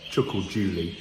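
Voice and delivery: ordinary delivery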